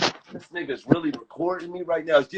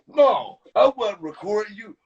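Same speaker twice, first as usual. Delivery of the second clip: imitating the recorder; 5 semitones higher, 3 dB louder than usual. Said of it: "And he like this".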